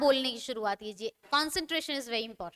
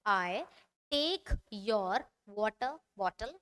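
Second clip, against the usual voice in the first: very slow, word by word; slow dictation; 5 semitones lower, 4 dB quieter, much slower than usual. Shown as a demonstration. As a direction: spell it out slowly for writing. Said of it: "teacher models slow dictation". (धीमी आवाज़ में लिखवाना)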